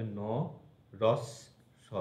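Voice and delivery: ন, রস, স in separate pieces; split pronunciation. Shown as a demonstration. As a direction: break it as ন – রস – স, pausing between the pieces. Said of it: "এরকম উচ্চারণ হবে".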